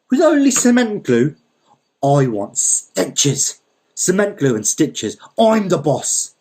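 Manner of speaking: high, loud, scornful berating; angry tirade. A narrator's reading. Landowner abusing the mender, loud and high-pitched. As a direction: tear into the old man like a bully boss. Don Lal berating Dima.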